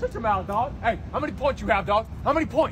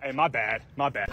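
ordinary delivery

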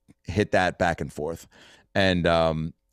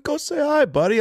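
much higher, playful needling voice; mock-high buddy nudge; Harlan egging him on. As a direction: push your pal forward, teasing.

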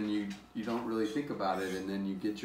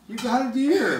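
high, loud burst of delight; excited; 14 semitones higher, 10 dB louder than usual. Real, not acted. Parent congratulating teen on deer, loud excited cheer. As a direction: cheer them on for their first deer. Praising a first deer kill.

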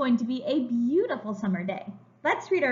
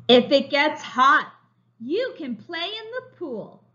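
slow, bright storybook reading; reading to children; 4 semitones higher, 5 dB louder, slower than usual. A narrator's reading. Teacher reading a picture book to young children, slow and bright. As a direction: read it like a picture book for little kids.

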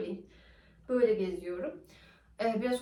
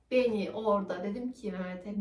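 ordinary delivery

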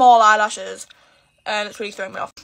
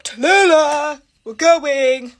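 louder mock call up the stairs, slow on the name; imitating dad calling; 4 semitones higher, 6 dB louder, much slower than usual.

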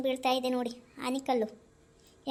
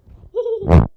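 big high laugh bursting out; loud burst of laughter; 7 semitones higher, 14 dB louder than usual. Acted. Child laughing loudly at the scolding mother.

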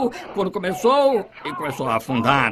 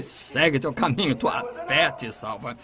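much lower, much softer words; soft blessing; 8 semitones lower, 6 dB quieter than usual. Retold or imitated. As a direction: grant it gently under the breath. Jesus sends the blind man.

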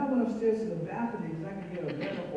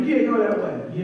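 a louder, barked-out retold command; mock guard order; 9 dB louder than usual. Retelling the officer who blocked her path, mock shout.

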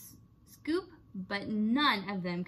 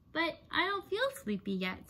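higher, little tortoise voice; a lightly voiced small child; 6 semitones higher than usual.

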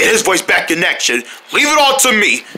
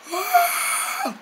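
voiceless croak; 9 dB quieter than usual. Acted. King tries to speak, voiceless.

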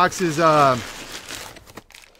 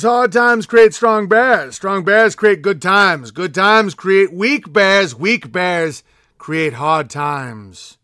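solemn slow proverb; 2 semitones higher, 6 dB louder, much slower than usual. Acted.

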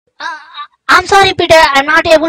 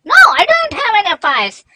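mock sobbing denial, higher pitched; hammed-up crying; 9 semitones higher than usual. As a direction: fake a tearful protest. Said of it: "don't act like you're crying".

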